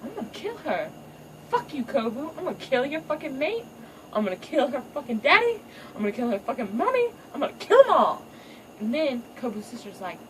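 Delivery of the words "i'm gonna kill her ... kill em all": high mock-villainous ranting; high hammed-up revenge spree; vengeful mama. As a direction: list every victim with relish. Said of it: "Kobu's mom was like".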